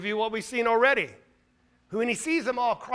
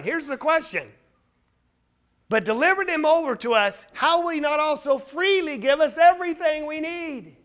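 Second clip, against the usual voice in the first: louder, higher, rising preacher's cry; preaching at full force; 6 semitones higher, 6 dB louder than usual. Acted. Preacher driving the big question home to the congregation, full force.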